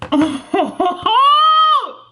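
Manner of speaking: high, loud squeal of delight; excited shriek. Real, not acted. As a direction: squeal as the gift appears. Unboxing, sees Kindle.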